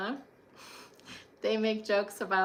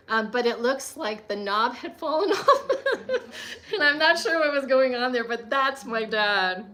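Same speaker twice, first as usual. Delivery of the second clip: talking through real laughter, louder; laughing; 2 semitones higher, 6 dB louder than usual. Real, not acted. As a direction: let the laugh spill into the words. Amused by the broken TV knob.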